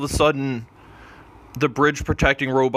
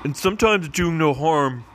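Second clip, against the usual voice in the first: raised pitch, earnest doctor role; doctor character voice; 3 semitones higher than usual. Acted. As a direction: talk him down as a cheery doctor. Doctor character pleads on bridge.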